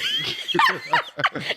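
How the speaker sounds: shrieking burst of laughter; real piercing laugh. Real, not acted. Laughing at the story.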